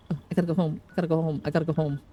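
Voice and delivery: normal talking voice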